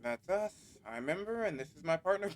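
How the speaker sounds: ordinary delivery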